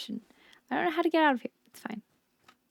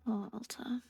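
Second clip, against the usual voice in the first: weary whispered sigh of a name; soft whisper, worn out; 11 dB quieter, slower than usual. Acted. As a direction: breathe the name out, defeated. Sighing at herself after collapsing.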